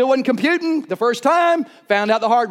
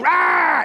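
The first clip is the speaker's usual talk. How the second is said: mock battle roar; 2 semitones higher than usual. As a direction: roar like you're grappling a goat. Wrestling goat battle cry.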